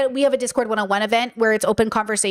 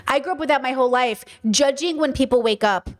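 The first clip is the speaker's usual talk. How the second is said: usual speaking voice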